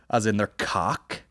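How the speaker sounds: ordinary talk, nothing marked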